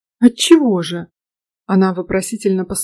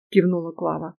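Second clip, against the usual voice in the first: ordinary delivery, nothing marked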